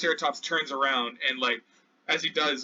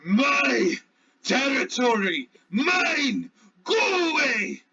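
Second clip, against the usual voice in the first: higher, shouted beast claiming its ground; territorial Triceratops; 8 semitones higher, much slower than usual. Acted. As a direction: bellow like a dinosaur guarding its turf. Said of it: "you hear the Triceratops say".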